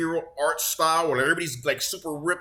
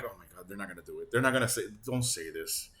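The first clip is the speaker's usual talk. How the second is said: dreading mutter; 8 semitones lower, 7 dB quieter than usual. Retold or imitated. Retelling his dread while watching.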